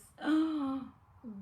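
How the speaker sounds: big audible breath; loud gasp. Real, not acted. Asked how she got into this, loud breath. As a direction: draw a loud breath before answering. Thinking how to answer question.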